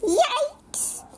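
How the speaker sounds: sudden high squeal of alarm; startled yelp. Acted. Monster reader startled at turning colours, high yelp.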